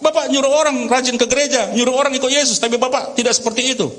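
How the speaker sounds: high, mock-tearful accusation; hammed-up wailing reproach; acted crying child. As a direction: play a daughter protesting to her father. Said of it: "Tambah nangis anaknya".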